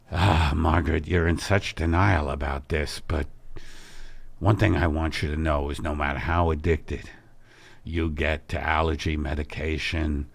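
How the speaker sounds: a low, earnest worried husband; Al's low, concerned voice. Acted. Husband voicing concern about wife's emptiness, low and grave. Al gentle.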